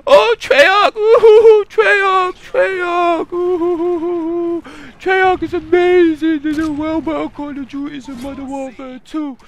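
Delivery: very high whooping mock fanboy voice; mock fanboy falsetto. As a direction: ridicule the fanboy in a squeaky cheer. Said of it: "They were like".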